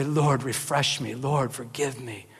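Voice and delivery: a soft, slow, hushed prayer to the Lord; humble prayer. Acted. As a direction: pray it gently and humbly.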